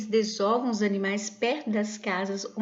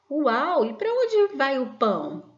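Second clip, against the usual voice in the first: loud, high-pitched wow of wonder; acted amazement; 5 semitones higher, 6 dB louder, slower than usual. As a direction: read the comic character as amazed and puzzled. Acted comic strip character reacting. (espanto encenado, alto e agudo)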